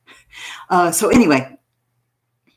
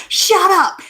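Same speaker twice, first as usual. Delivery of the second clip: shut up; 10 semitones higher, 5 dB louder than usual. Retold or imitated. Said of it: "people would be like".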